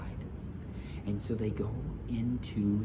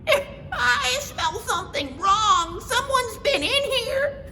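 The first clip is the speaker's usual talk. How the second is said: very high, near-crying cub; whimpering Baby Bear; 7 dB louder than usual. Acted. Baby Bear sniffing the house, very high and whiny. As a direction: whine it like a scared little cub. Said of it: "he started almost to cry".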